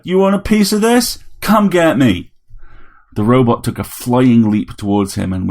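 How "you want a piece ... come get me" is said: loud, high fighting dare; defiant challenge. A narrator's reading. Harry dares the robot to leap at him, defiant. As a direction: goad the enemy into charging at you.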